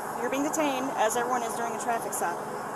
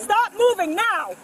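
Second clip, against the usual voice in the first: loud command; 8 semitones higher, 6 dB louder, much slower than usual. Real, not acted. Officer restraining detainee.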